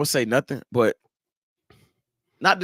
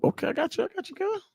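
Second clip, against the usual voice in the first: put-on much higher, soft girly teasing voice; mock girly voice; 10 dB quieter, faster than usual. Host teasing his guest about the military, in a high soft girly voice. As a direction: tease him in a high soft girl voice.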